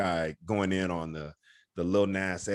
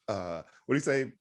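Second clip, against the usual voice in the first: ordinary delivery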